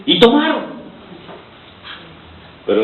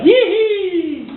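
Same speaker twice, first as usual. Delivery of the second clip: high mock cheer of the crowd; joyful whoop. Preacher voicing the Israelites' celebration, high whoop. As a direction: squeal it like celebrating people. People start singing after crossing.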